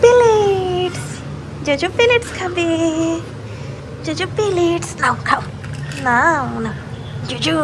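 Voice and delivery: high, slow sing-song call to the cat; cooing to the cat. Real, not acted. Owner calling her cat to its food in a high coo.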